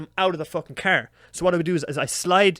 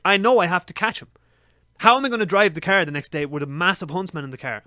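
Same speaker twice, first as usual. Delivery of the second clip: normal talking voice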